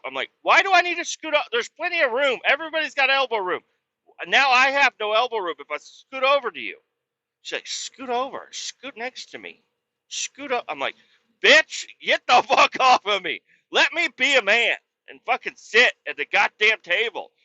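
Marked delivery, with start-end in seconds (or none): 0.4-6.8 very high-pitched mock protest; exasperated protest. Acted quarrel over seating. 7.7-10.6 high, soft nagging voice. Retold or imitated. Retold nagging order to move over. 11.4-17.3 high, loud mock rant; exasperated outburst. Acted outburst at the nagging.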